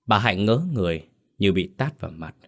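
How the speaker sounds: plain reading voice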